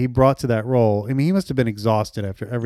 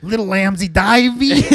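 DeVito quote voice; 6 semitones higher, 4 dB louder than usual. Retold or imitated. Quoting DeVito line from Heist.

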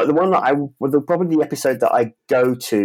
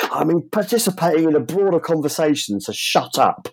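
higher-pitched terrace jeer, mock dismissive; football fan voice; 7 semitones higher than usual. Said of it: "is that your football fan voice".

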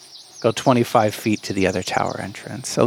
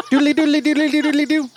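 quick, high doodly spell sound; sing-song magic noise; faster than usual. Acted. Leprechaun casting a transformation spell, fast, high sing-song noise. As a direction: rattle off a silly high incantation.

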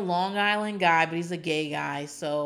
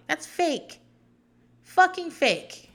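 much higher, slow, scornful fucking fake; scoffing; 10 semitones higher, 4 dB louder, much slower than usual. Real, not acted. Scoffing at a fake phone call on the show, much higher. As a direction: call out the fakery with disdain.

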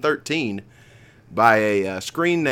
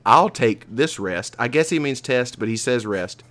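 usual speaking voice

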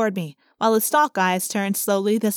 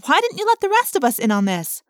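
higher, sharper demand; 7 semitones higher than usual. A narrator's reading. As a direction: press him for an answer. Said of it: "I demanded".

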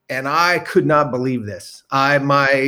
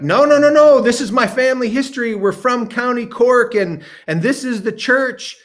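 insistent protest; 9 semitones higher than usual. Retold or imitated. Protesting to the old woman.

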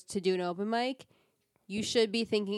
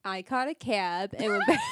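laughing; 9 semitones higher than usual. Real, not acted. Laughing while attempting test sentence.

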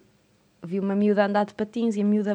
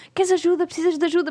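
concerned calling out; 7 semitones higher than usual. Retold or imitated. Calling to fallen skater.

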